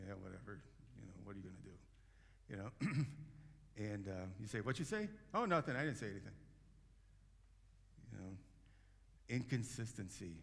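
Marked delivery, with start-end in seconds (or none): 0.1-1.8 sulky mumble. Retold or imitated. Person mumbling under breath. 4.6-5.1 sharp challenge. Retold or imitated. Confronting a mumbler. 5.3-6.3 innocent denial. Retold or imitated. Person denying it.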